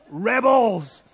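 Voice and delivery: loud, high mock battle cry; mock rebel cry. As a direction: shout it like a playful revolutionary. Mock rallying cry about supermarket choice.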